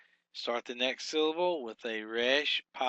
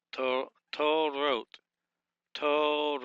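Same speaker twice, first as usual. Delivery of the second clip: slow, drawn-out Torot; teacher's deliberate Torot; broken into syllables; 3 semitones higher, slower than usual. Shown as a demonstration. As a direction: sound out Torot slowly, stretching the first syllable. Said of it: "Break that".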